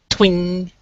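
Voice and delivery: high vocal twang; high ringing "twing" sound effect; snapping string. Acted. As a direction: imitate a taut string going twang.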